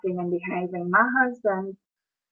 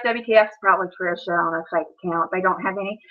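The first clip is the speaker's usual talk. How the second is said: normal talking voice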